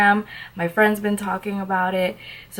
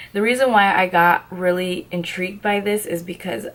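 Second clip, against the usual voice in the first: ordinary talk, nothing marked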